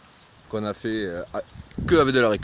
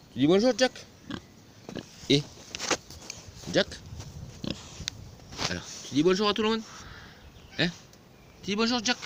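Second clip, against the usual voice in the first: pet talk; 10 semitones higher, 5 dB quieter, faster than usual. Real, not acted. Owner coaxing the pig to greet viewers, high baby talk. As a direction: coo at him like a pet.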